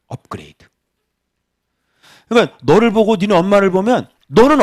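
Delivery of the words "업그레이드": softer, lower whisper; a breathy side remark; whispered aside. Real, not acted.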